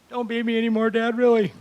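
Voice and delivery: high, whiny-kid plea, played for laughs; mock pleading child. Kid begs Dad to stop beating, mock pleading.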